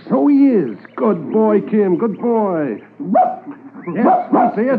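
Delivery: high, loud, delighted praise for the dog; excited praise. Acted. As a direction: cheer the dog on as it finds the mound. Corporal praises dog finding mound.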